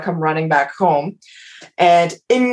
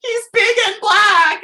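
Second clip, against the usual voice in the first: high, slow sing-song wail; mock bawling; 14 semitones higher, slower than usual. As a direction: wail it out like a hysterical mess, hammed up. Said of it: "I'm, like, bawling and calling".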